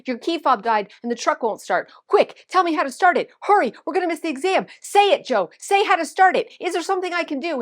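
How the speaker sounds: high, loud, urgent pleading; panicked. Retold or imitated. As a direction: fire off frantic demands. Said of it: "I'm so panicked".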